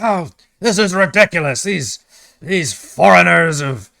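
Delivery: high, loud indignant sputter; blubbering outrage. Acted. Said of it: "he starts blubbering again".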